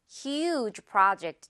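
huge project drawn out, project with an open ah; slow model phrase. Shown as a demonstration. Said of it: "정말 큰 프로젝트야".

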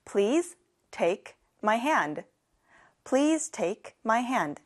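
very slow, carefully spaced English; slow classroom demo; slow model sentence. Shown as a demonstration. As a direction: say it slowly for learners to repeat. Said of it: "같이 해볼까요?".

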